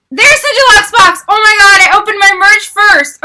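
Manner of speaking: loud, high outburst; excited realisation. Real, not acted. Vlogger realising she opened her merch first, excited outburst. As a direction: burst out loudly with the discovery.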